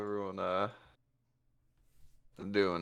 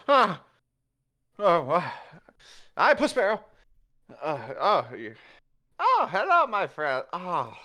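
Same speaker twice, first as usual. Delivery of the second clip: high flustered yelps, stumbling over greetings; startled awake; 6 semitones higher than usual. Acted. Kicked awake in his chair, high startled babbling. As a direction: jolt awake and babble hello.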